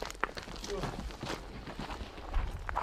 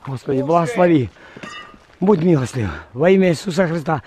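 fervent lower-voiced prayer call; loud prayer; 10 semitones lower, 3 dB louder than usual. Real, not acted. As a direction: pray out loud before battle. Prayer before firing. (громкая молитва)

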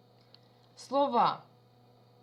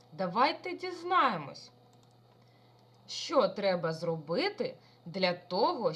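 usual speaking voice

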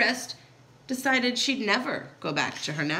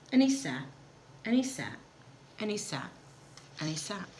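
each repeat lower and softer; fading repetition; 4 semitones lower, 8 dB quieter, faster than usual. A narrator's reading. Narrator showing Horton's endless sitting, fading repetition. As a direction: let the waiting dwindle away, pausing between repeats.

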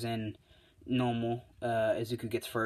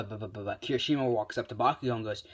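usual speaking voice

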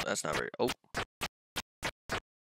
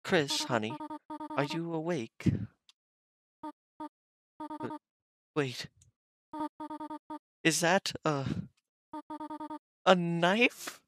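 higher-pitched British mother voice; high, lightly British motherly voice; soft British accent; slower than usual. Acted. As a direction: voice a British mom in a gentle accent. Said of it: "It was more of a soft British accent".

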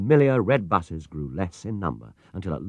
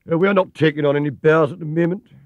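louder, higher stern gatekeeper; sternly; 7 semitones higher, 6 dB louder than usual. A narrator's reading. Gatekeeper turning the bear away at the gate, sternly. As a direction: brush him off officiously. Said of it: "he said sternly".

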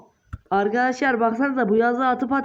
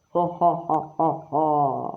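evil laugh; 6 semitones lower, slower than usual. Acted. Villain laugh in game.

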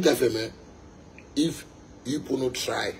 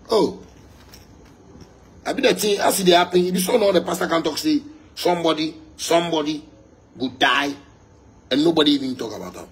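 worked-up rant; 5 semitones higher, 6 dB louder, faster than usual. Real, not acted. Worked-up rant about pastors.

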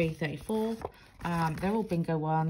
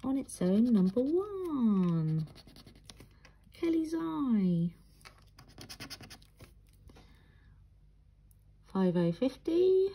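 slow, high sing-song bingo calls; bingo caller; 5 semitones higher, slower than usual. Retold or imitated. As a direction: call the numbers like a bingo hall. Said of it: "from the bingo lingo".

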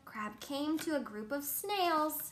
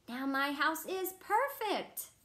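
higher, louder, slow cheer; 5 semitones higher, 6 dB louder than usual. A narrator's reading. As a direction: cheer that the house is finally done. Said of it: "cheered Hermit Crab".

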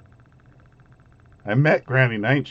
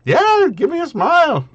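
NPC voice; 7 semitones higher than usual. Acted.

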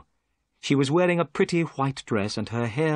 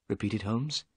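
ordinary delivery, nothing marked

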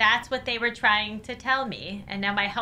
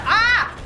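shriek; 10 semitones higher, 6 dB louder than usual. Real, not acted. Tense moment, shrieks.

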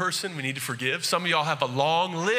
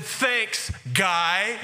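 thanks pitched up, with a snide edge; sarcastic comeback; 3 semitones higher than usual. Retold or imitated. To the cashier, a mock snide thanks guy. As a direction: fire it back as a dig. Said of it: "I fire back".

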